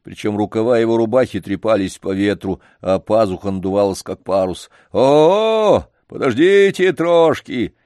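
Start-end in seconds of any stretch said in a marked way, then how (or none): 4.9-7.8 high, loud call from a distance, slow; loud shout of a janitor running downhill. Acted. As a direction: call out to stop them. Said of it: "Дворник продолжал кричать".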